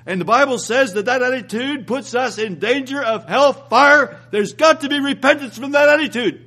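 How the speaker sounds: ordinary delivery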